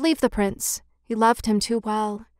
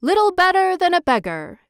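much higher, louder eager echo; hammed-up chorus of yes-men; councilors' echo; 8 semitones higher, 4 dB louder than usual. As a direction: copy the mayor's words keenly. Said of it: "said the town councilors".

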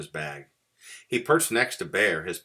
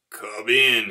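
louder, higher call in a sick bear's rasp; 5 semitones higher, 8 dB louder than usual. A narrator's reading. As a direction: rasp it out to a visitor. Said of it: "he rasped".

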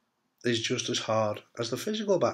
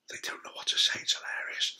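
hushed, amused whisper; whispered aside; 7 dB quieter than usual. Real, not acted. Patient telling viewers about awkward shoppers, whispered.

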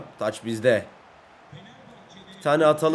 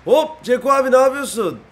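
high, loud happy hello to a teammate; light up as you greet your buddy; excited greeting; 7 semitones higher, 7 dB louder than usual. Pretend. Gamer greeting teammate Ceko on the pitch, excited.

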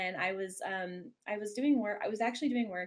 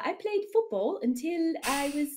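higher, wobbly put-on British; attempted British accent; 6 semitones higher than usual. Shown as a demonstration. Guest answering about football, higher attempted British accent. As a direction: try a Mary Poppins British accent. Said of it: "what is that accent".

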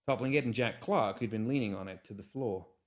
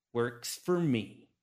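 slow curt reply; much slower than usual. Acted. Curt duel acceptance.

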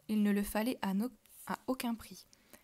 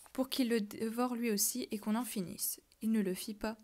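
usual speaking voice

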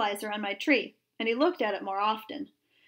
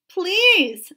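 pleading; 7 semitones higher, 9 dB louder than usual. A narrator's reading. Boy pleads to save his tree.

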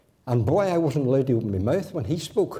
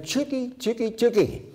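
calling the hens; 8 semitones higher, 3 dB quieter than usual. Retold or imitated. Great aunt calling the hens in the garden, high sing-song. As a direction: coax the chickens in, high and lilting. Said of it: "she shouted".

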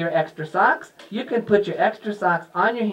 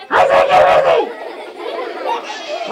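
shouted, drawn-out hen squawk; little red hen squawk; much slower than usual. Pretend. Hen repeats her order to children, squawked shout. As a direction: squawk the order slowly and forcefully. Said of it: "I sound like this".

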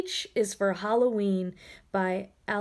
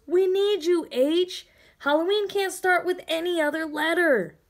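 higher, urgent plea; pleading; 7 semitones higher than usual. Acted. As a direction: beg the letter to step up. Letters pleading with H.

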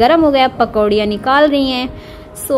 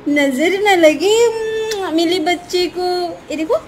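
put-on sing-song cooing to a baby, higher and lilting; baby talk; 4 semitones higher than usual. Aunt playing with her baby niece, sing-song baby talk. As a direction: coo and tease the little one like a doting aunt.

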